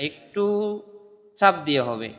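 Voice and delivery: ordinary delivery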